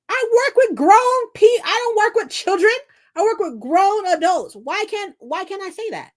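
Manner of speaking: high, emphatic retold complaint; worked-up pleading of your case; exasperated protest. Acts out arguing with manager.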